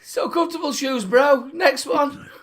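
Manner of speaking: laughing to tears, high-pitched; can barely talk for laughing. Real, not acted. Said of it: "What are those two cracking up at".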